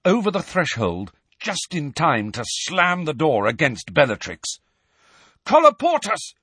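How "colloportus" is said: high, loud spell shout. A narrator's reading. Harry shouts a sealing spell, high and loud. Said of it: "shouted Harry".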